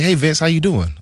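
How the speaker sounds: a hint of an upbeat team exec; casual GM greeting. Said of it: "He's like".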